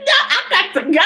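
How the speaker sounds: high, laughing "no, I got to go"; laughing refusal. Retold or imitated. Turning down the offer to stay, laughing. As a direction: bail out with a laugh. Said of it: "I'm like".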